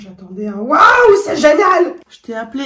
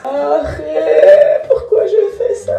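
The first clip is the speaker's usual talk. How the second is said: laughing; 5 semitones higher, 4 dB louder, much slower than usual. Real, not acted. Laughing at her own accent.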